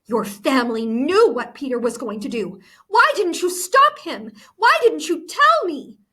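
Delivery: loud, high outraged questions; furious accusation. A narrator's reading. Anna accuses her friend, loud and furious. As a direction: hurl the blame at her.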